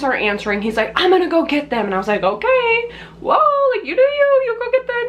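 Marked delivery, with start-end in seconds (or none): 1.0-1.8 raised-pitch imitation of a panicking friend; mildly mock-panicked; freaking out. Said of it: "he's, like, freaking out". 2.2-5.1 very high-pitched, startled retelling; high, hammed-up back-off reaction; mock alarm. Reacting to friend's panicked plan.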